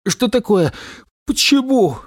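high, alarmed questions; panicked why; worried. A narrator's reading. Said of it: "заволновался Дикобраз".

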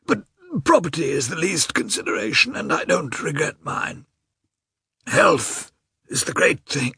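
a dying man insisting he's fine; frail man forcing cheer. A narrator's reading. Nikolai voiced in narration.

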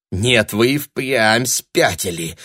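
slow, drawn-out disbelief; astonished. A narrator's reading. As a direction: marvel at the madness, stretching the words. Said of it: "изумлённо сказала Таня".

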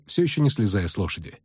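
ordinary delivery

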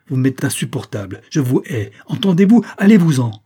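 furious outburst rising to a high shout; redoubled anger. A narrator's reading. Young woman driving the visitor out, anger mounting to shouting. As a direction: build to a loud, high dismissal. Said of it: "la colère de la jeune fille redoubla".

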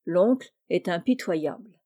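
ordinary delivery, nothing marked

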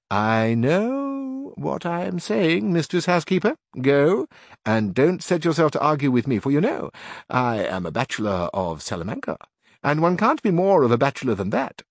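lightly voiced Salamanca bachelor; self-assured bachelor. A narrator's reading. Carrasco, slow start.

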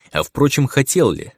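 ordinary delivery

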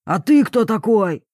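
impatient roar. A narrator's reading. Papa challenges the stranger in the tent, roaring. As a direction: demand it, losing patience. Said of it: "взревел папуля".

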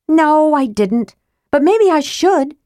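high, slow defiant threat. A narrator's reading. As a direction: tease the threat slowly. Tika voiced, defiant.

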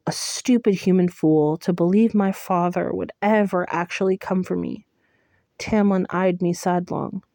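usual speaking voice

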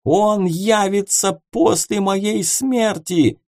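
high, slow terrified cry. Acted. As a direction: warn of doom in terror. Ghost in terror.

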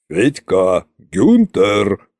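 низкий властный оклик, протяжно; окликни слуг низко, по-царски; громкий царский окрик. A narrator's reading. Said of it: "перебил меня громкий голос царя-батюшки". (повелительный оклик царя)

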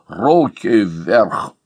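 very slow, rough, menacing robber's voice; rough scary rasp. A narrator's reading. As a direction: growl it out to scare him stiff. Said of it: "прохрипел грубым страшным голосом".

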